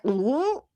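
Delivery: high comic gulp; a mock cartoon swallow. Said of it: "the full, like, cartoon".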